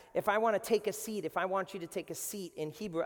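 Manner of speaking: ordinary talk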